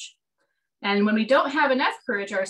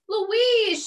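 much higher, louder joyful call; relieved cry; 11 semitones higher, 4 dB louder than usual. Acted. Lost Sheila Wray spotting her sister, relieved high call. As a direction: shout her name in relief.

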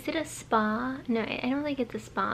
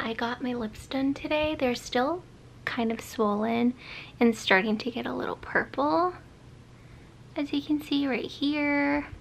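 usual speaking voice

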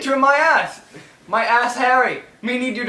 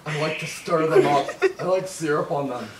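much lower, hammed-up seductive purr; mock sultry voice; 9 semitones lower, 6 dB quieter than usual. Joking with a friend about pancakes, mock sultry low voice. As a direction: drop way down and ham up the seduction.

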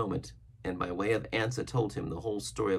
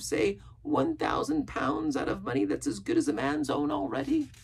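very high-pitched castaway character voice; Ben Gunn. Acted.

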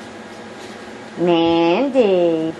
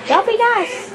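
excited. Real, not acted. Watching dogs play excitedly.

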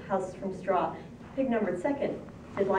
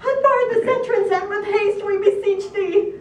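very high, loud pleading shout; shrieked plea, pitched way up; 11 semitones higher, 8 dB louder than usual. A narrator's reading. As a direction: shout desperately at a shut door.

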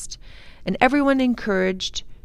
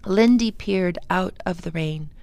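ordinary delivery, nothing marked

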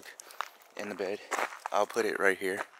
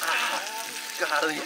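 startled yelp; 13 semitones higher, 4 dB louder, slower than usual. Real, not acted. Grease popping while cooking bacon.

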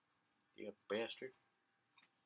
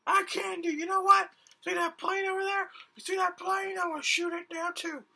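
high-pitched, louder defiant character; 7 dB louder than usual. Acted. Game character refusing the host's challenge, high and defiant. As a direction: squeak out a stubborn refusal. Character boasts about plane.

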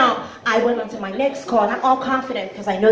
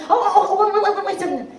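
high, rapid stammer of disbelief; flustered; 7 semitones higher, faster than usual. Acted. Client halting the collector over the bill, flustered. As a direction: cut in with a startled string of waits. Client interrupts after hearing debt.

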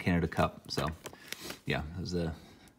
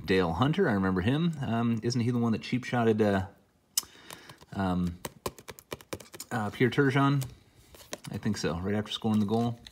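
ordinary delivery

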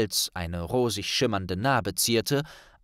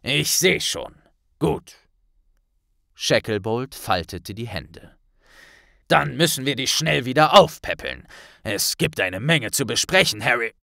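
a lightly voiced solemn minister; grave Kingsley; 2 semitones higher, 6 dB louder than usual. A narrator's reading. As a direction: minister briefing Harry and Hermine, grave. Kingsley speaks.

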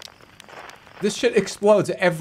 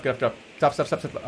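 ordinary talk, nothing marked

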